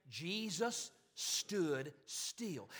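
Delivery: very slow, each word separate and stretched; slow, deliberate repetition. Shown as a demonstration. Preacher leading the congregation to repeat it, slow and measured. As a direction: give "Jesus", "stood", "still" room to sink in.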